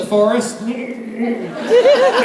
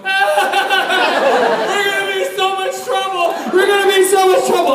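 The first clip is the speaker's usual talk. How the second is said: high-pitched hammed-up wailing; comic frightened squeal, words tumbling out; mock panic; 10 semitones higher than usual. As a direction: imitate a panicking boy, voice way up. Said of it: "just stood in the middle of the circle going".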